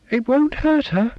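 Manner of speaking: put-on a child whining at her dad, pitched high; small girl voice. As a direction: voice a fussy little girl. Child Sonia states flatly.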